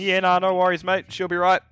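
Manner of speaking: laid-back Kiwi bloke; Kiwi accent. Retold or imitated. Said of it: "into my normal Kiwi voice".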